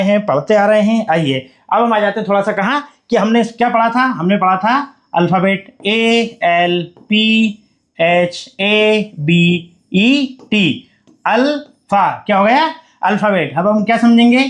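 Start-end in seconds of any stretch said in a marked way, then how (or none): 5.9-12.1 each letter drawn out slowly; teacher spelling aloud slowly. Shown as a demonstration. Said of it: "उसको बार बार repeat करेंगे".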